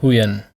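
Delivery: Huyền dropping in pitch; falling tone. Shown as a demonstration. Teacher introducing the next tone, Huyền falling. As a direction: let Huyền sink. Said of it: "the falling tone".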